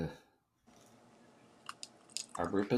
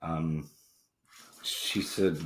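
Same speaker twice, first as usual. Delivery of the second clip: ordinary delivery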